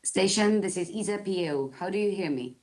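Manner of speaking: sci fi communicator. Real, not acted. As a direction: voice over radio link. ESA calling over radio loop.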